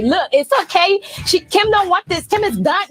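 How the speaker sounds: high, mocking voice of someone dismissing Kim; sarcastic imitation up high; mock label voice.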